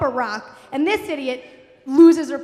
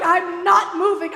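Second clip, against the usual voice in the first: loud, slow, high protest; stubborn refusal; 5 semitones higher, 7 dB louder, slower than usual. Retold or imitated. Mother clinging to the boulder, loud stubborn refusal. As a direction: imitate a mother digging in, hollering.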